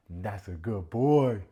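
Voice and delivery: put-on slow, loud praise for a small child; talking to a little kid. Mocking Sandy praising her man like a child. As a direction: praise him like a toddler, slowly. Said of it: "talking to this dude like he's a little kid".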